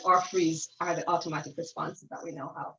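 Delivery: normal talking voice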